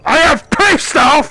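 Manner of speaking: very high, loud, slow yell of real anger; explode, stretching each word out; pissed-off shout. Real, not acted. Gamer announcing he is really pissed off, furious shout. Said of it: "I am really fucking pissed off".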